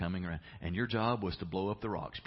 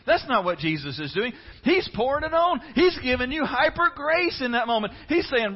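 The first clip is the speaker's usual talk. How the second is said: louder, higher, driving preacher voice; full-force preaching; 10 dB louder than usual. Acted. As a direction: preach it to the rafters.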